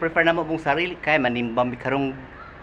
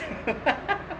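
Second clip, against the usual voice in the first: soft rising giggle; a little embarrassed laugh; quiet high giggle; 10 semitones higher, 8 dB quieter than usual. Real, not acted. Laughing about sponsored outfit.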